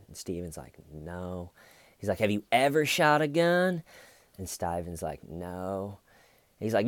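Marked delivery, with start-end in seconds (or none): 0.9-1.5 quiet retold denial. Acting out Steven's meek answer. 2.2-3.8 raised pitch, imitating an incredulous interrogator; mock disbelief. Detective incredulously grilling Steven, acted. 5.3-6.0 quiet retold denial. Acting out Steven's meek answer.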